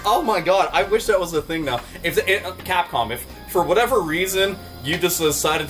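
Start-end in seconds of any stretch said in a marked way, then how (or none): none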